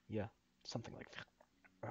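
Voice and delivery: botched character line as the voice cuts out; real vocal slip, barely voiced; voice giving out. Real, not acted. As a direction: voice fails into an unvoiced croak. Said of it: "my voice screwed up".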